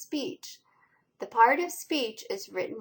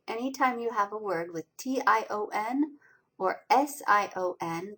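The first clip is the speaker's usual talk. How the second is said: ordinary talk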